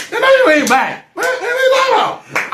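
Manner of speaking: high squealing disbelief; mock outrage. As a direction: let the challenge climb into a comic falsetto. Acted outraged challenge to her claim.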